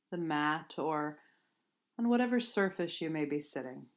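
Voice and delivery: unhurried, gentle meditation guidance; guided meditation. Acted. Yoga teacher guiding listeners into seated movements, calm guided meditation. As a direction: ease them into the first stretch.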